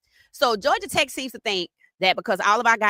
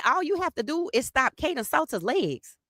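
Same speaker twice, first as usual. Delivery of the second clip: higher, mocking echo of the rival's words; a higher sneering echo of the rival claim; mock repeat; 7 semitones higher than usual. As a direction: parrot them back with scorn. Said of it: "I said".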